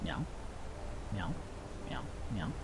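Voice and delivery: little rising cat mews; Kyber. Retold or imitated. Imitating the pet cat while playing, mewing. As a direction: mew like a cat, fading to tiny meows. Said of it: "That's a pretty good impression of Kyber".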